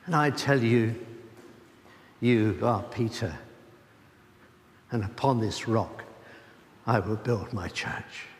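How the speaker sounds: low, measured voice of Jesus; quoting Jesus. Acted. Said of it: "And his amazing reply".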